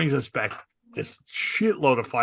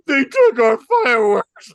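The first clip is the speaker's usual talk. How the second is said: put-on high, loud whiny kid's voice; 11 semitones higher, 8 dB louder than usual. Little brother complaining cops took fireworks, high whine. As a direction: wail it like an indignant little kid. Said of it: "he's going".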